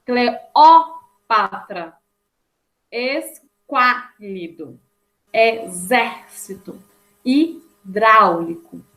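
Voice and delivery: slow word list, "Cleópatra" broken with a strong "ó"; drawn-out teaching diction, "esquálido" leaning on "quá"; stressed antepenultimate syllable. Shown as a demonstration. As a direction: hit the accented syllable in "cáustico", "exército", "líquido". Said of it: "sendo a mais forte na hora da pronúncia".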